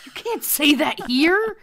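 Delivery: mock scolding hush. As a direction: hush them like they said something rude in public. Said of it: "I said".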